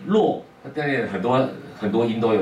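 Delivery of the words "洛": the character read as 洛 instead of 路; wrong reading 洛. Retold or imitated. Teacher quoting students who read the character as 洛. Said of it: "念".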